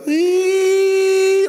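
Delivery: translator's squealed emphasis sound, held on one note; long high-pitched eee. Retold or imitated. Preacher imitating his Burmese translator, long high eee. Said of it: "he would go".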